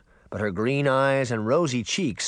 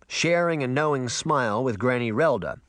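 ordinary delivery

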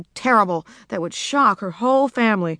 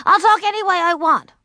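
put-on high, loud defiant child; high loud stubborn insistence; defiant outburst; 10 semitones higher, 6 dB louder than usual. A narrator's reading. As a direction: talk back to father, loudly. Child defying her father.

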